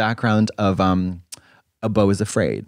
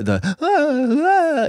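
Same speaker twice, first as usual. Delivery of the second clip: high, drawn-out stammering ahs; mock stammer; much slower than usual. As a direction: squeak out helpless ahs. Acting out a rambling winner.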